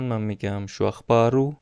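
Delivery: ordinary talk, nothing marked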